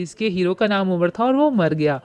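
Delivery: ordinary delivery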